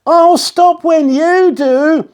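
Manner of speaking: put-on high, slow girl's call; cheerful girl. A narrator's reading. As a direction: call back to the crocodile happily. Katie calling.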